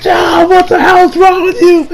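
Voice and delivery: high-pitched mock outraged yell; outraged scream. Senator yelling at Dio, hammed-up pained outcry. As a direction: yell it in hammed-up agony, close to laughing. Retold outcry of injured man.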